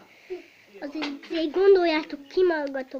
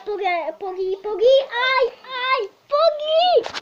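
calling out; 7 semitones higher, 4 dB louder than usual. Real, not acted. Dog won't hold still, calling.

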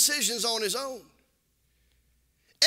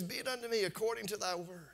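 hushed surrender; 5 semitones lower, 12 dB quieter than usual. Acted. Mary's quiet submission.

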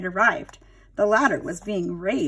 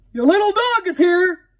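pet store man on the phone; 9 semitones higher, 9 dB louder than usual. Acted. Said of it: "he said".

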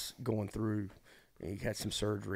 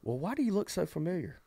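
put-on old lady voice; 9 semitones higher than usual. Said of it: "she goes".

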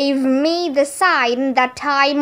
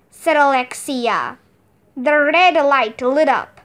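ordinary delivery, nothing marked

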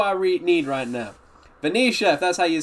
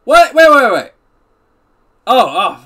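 loud, higher sudden wait wait; startled; 5 semitones higher, 10 dB louder, slower than usual. Real, not acted. Streamer startled by gifted subs, loud surprised. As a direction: stop everything, startled.

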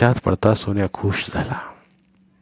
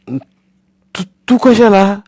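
put-on a higher, hurried boy's voice; flustered boy; 11 semitones higher, 5 dB louder, much slower than usual. Boy Sonya stopping grandmother from coming, flustered. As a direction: caught off guard and stalling.

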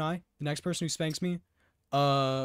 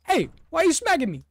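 ordinary talk

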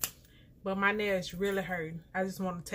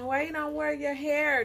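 mimicking askers; 6 semitones higher, 4 dB louder than usual. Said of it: "People always ask me".

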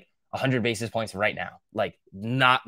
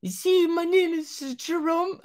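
much higher, slow, hammed-up French accent on "Jerome"; mock French accent; slower than usual. Imagined French girl introducing herself, in a put-on French accent. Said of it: "cool accent".

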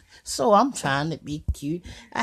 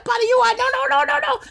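very high, panicky little voice; the stuffed bird refusing to go, tiny frantic squeal; scared stuffed bird; 5 dB louder, faster than usual. Pretend. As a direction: squeal like a terrified toy. Bird voice cuts in.

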